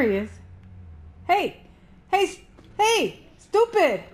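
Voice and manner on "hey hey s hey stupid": loud, high, short calls; taunting shout. Real, not acted. Player goads monster to come after them, high taunting shout. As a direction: shout to get the beast's attention.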